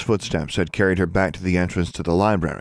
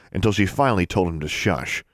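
usual speaking voice

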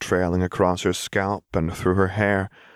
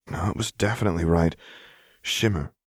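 ordinary delivery, nothing marked

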